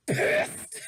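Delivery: sudden high, loud burst on one word; angry outburst. Acted. Narrator arriving at the manor, angry yell. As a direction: explode on the word. Narrator's anger acted out loudly.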